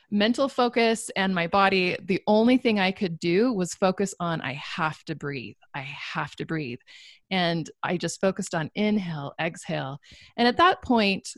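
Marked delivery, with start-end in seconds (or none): 4.4-6.8 strained inner thought. Retold or imitated. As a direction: tell yourself to breathe, softly. Breathing through a crisis.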